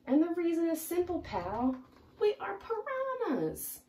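lecturing the pal; high squeaky piranha. Acted. Piranha explains.

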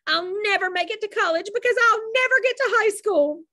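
high, loud wail of despair; a girl sobbing out her doom; girl wailing to her mother; tearful despair. A narrator's reading.

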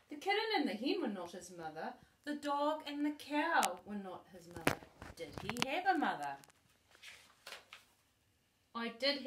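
animated read-aloud, bigger at each question; lively storybook reading. Acted. Grandma acting out the story for her granddaughter, lively storybook voice. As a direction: read it with growing excitement for the child.

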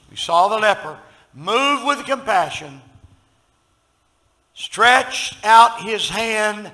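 loud, drawn-out preaching, slowing on key phrases; building preacher's cry over the leper story; full-force preaching. Acted. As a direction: preach it out big to the congregation.

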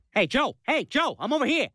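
calling out. Acted. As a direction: call a friend over to you. Competing to call a friend over.

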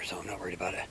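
breathy whisper aside. Real, not acted. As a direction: drop to a whisper. Unvoiced aside while hiking.

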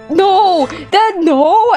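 ordinary delivery

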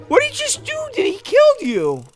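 high-pitched alarmed question; shocked. Pretend. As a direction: squeal the question in disbelief. Shocked at the monster's attack.

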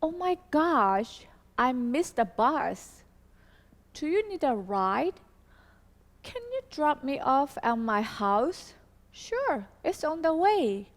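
slow, clear textbook dialogue reading; slow demo dialogue. Shown as a demonstration. Teacher reading a sample dialogue aloud to students, slow and clear. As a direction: read each line slowly for learners to copy. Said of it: "A부터 얘기해요".

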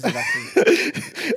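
high, drawn-out words through laughter; laughing. Real, not acted. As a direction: crack up while asking. Joking, cracking up.

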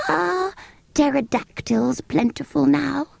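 very high, slow creature question; curious sand fairy. Acted. Sand fairy quizzing the children, high and slow. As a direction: ask it like an odd old beast.

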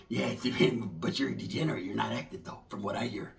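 low, soft put-on old-timer voice; old vato. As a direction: growl it low like a jaded veteran. Same vato dismissing him.

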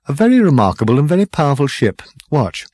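brisk, self-satisfied official; quick smug showman. A narrator's reading. Zarniwoop shows the ship.

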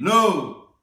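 loud flat refusal; firm no. Acted. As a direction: refuse them firmly. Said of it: "And Max said".

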